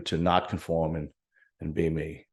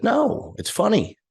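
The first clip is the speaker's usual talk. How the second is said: a retold quick defensive no, pitched up high; protesting it's funny; 7 semitones higher than usual. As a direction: insist it's hilarious, starting high and excited then settling. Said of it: "And I was like".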